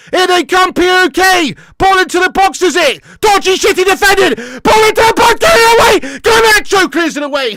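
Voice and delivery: very high, loud panicked calling of the play; frantic live commentary. Real, not acted. Fan commentating a dangerous cross to chat, screaming. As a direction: yell the attack as it happens.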